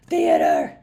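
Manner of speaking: loud, low theatrical boom; sudden mock-grand shout; mock theatrical cry. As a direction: boom it out hammily after a stumble. Mock exclamation after stumbling over reading.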